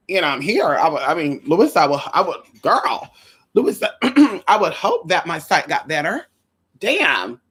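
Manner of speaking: ordinary talk, nothing marked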